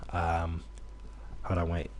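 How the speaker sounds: ordinary delivery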